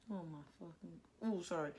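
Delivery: normal talking voice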